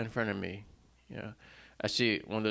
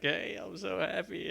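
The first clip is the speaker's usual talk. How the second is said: high-pitched, over-the-top mock cheerfulness; mock a grinning pill-commercial actor; giddy ad actor; 10 semitones higher, 3 dB quieter than usual. Mocking a drug ad's actor, hammed-up gushing happiness. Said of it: "and the person's like".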